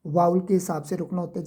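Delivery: ordinary talk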